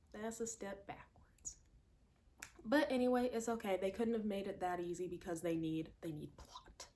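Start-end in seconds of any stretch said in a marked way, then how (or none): 0.1-1.6 whispered. Real, not acted. Repeated, whispered. 6.0-6.9 wry whisper. Real, not acted. Sarcastic hushed aside.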